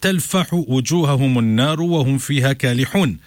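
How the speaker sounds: fast melodic Arabic chant of the verses; Quranic recitation. Acted. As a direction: intone the verses as tilawa.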